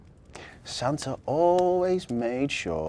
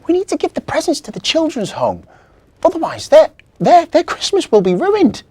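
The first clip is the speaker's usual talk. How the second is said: put-on high, loud child voice; urgent little girl; 13 dB louder than usual. A narrator's reading. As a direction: plead hard, stumbling over words. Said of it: "whispered Victoria".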